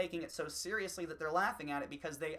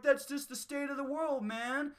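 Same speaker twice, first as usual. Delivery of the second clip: high, put-on complacent voice; dismissive shrug; 10 semitones higher, 4 dB louder than usual. Complacent people dismissing inequality, high mock shrug. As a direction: toss it off like a shrugging stoner. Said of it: "people are like".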